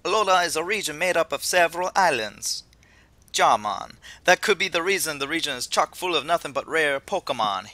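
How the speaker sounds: put-on lively, amateur Jamaican accent; attempted Jamaican accent. Host reads the professor's welcome to Alola, attempted Jamaican accent. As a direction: ham up a Jamaican professor, loosely. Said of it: "I can't do a Jamaican accent".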